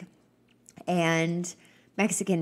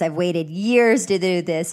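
normal talking voice

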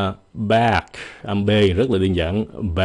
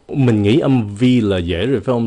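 normal talking voice

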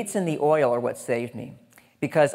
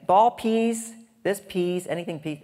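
lightly voiced toddler saying peas; a hint of little kids lisping please; kids mispronouncing; 5 semitones higher than usual. Imitating his small kids saying please. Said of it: "My kids are running around saying".

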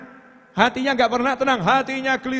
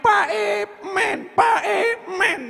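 put-on high, slow child's whine; whining child; 11 semitones higher, much slower than usual. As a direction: beg like a wailing little kid. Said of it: "anak kecil itu merengek".

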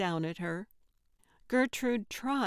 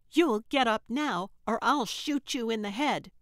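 much louder, menacing threat; 3 semitones higher, 5 dB louder than usual. Acted. Trixie threatening to shoot Gertrude, loud menace. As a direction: shout the threat with the gun raised.

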